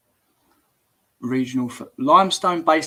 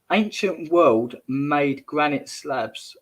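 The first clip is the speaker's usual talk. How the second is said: slow reread; much slower than usual. Shown as a demonstration. Rereading the comment slowly.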